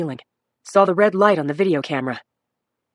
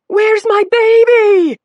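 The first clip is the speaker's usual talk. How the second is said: high, drawn-out frantic scream; 9 dB louder, much slower than usual. A narrator's reading. Injured mother screaming for her baby. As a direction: scream it in panic for her child. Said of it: "she screamed".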